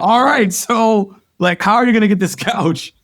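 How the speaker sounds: loud, high-pitched retold question; animated manager. Manager to rep fresh out of college, animated. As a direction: play up an eager manager's challenge.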